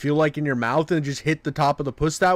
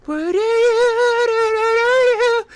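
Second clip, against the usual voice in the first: very high, stretched ad jingle noise; hammed-up commercial opener; parody TV ad; much slower than usual. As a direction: wail it out high and long. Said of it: "one ad that comes on".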